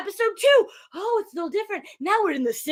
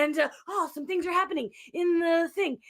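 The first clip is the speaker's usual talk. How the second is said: normal talking voice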